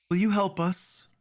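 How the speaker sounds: high, small voice asking for help; pleading little girl. Acted. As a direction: ask like a scared child.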